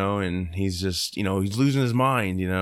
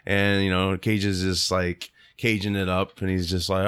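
usual speaking voice

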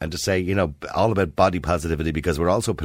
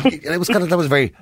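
ordinary talk, nothing marked

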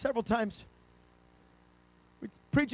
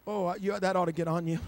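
usual speaking voice

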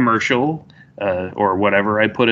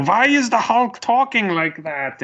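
high squeaky nerd voice; high voice as Zemo; 13 semitones higher than usual. Retold or imitated. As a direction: pitch way up for the nerd. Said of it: "He's like, he's like".

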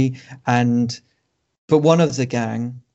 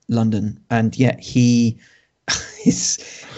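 usual speaking voice

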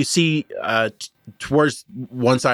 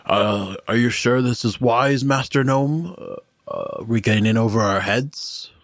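Kierkegaard voiced; slower than usual.

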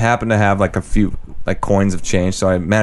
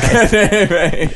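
put-on Australian accent; 11 semitones higher, much slower than usual. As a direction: laugh out a hammed-up Aussie "g'day mate". Echoing the Aussie greeting, laughing.

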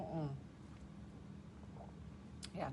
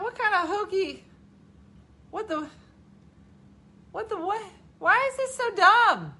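high, sputtering bafflement; baffled, building to loud exasperation; mock disbelief; 13 semitones higher, 5 dB louder than usual. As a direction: sputter in high comic dismay. Recalls dismay at rewatching.

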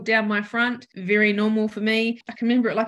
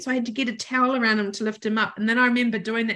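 usual speaking voice

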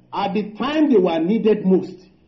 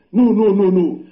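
loud, laughing string of protests; emphatic mock refusal; 7 dB louder than usual. Preacher retells refusing the scripture, loud comic protest. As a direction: blurt out a playful, loud refusal.

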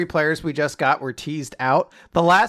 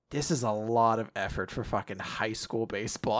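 tired mutter; 7 semitones lower, 11 dB quieter than usual. Pretend. Imagined tired walker muttering.

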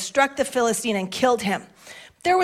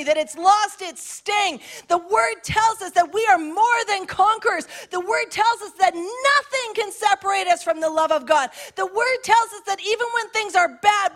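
higher, rising sermon cadence; fiery preaching; 7 semitones higher than usual. Acted. Pastor rallying the congregation, preaching at full force. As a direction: build it like a revival preacher.

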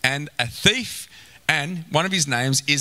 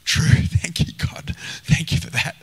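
grateful whisper. Retold or imitated. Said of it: "Where it's like".

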